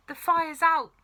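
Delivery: put-on boy proudly announcing the fire is out; announced proudly. A narrator's reading. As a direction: declare it like a little hero. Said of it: "George announced proudly".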